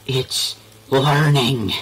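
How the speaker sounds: slow ominous drawl. Acted. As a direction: spell it out like a horror trailer. Horror at tuxedo zombie.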